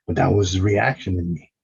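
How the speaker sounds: ordinary delivery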